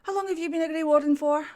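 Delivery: a touch of coaxing; confiding character. Acted. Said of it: "just be like".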